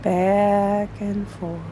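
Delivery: slow, soft rocking rhythm; quiet lulling narration; soothing. A narrator's reading. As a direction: lull it gently.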